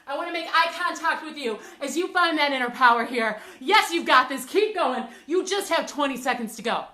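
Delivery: loud, driving encouragement; pumped-up coaching. Real, not acted. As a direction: push them hard through the burn. Coach pushing through final seconds.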